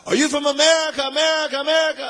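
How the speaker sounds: high, loud call with each echo repeated; echoing shout. Retold or imitated. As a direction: shout it across a distance, echoing the last word. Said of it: "and it echoes".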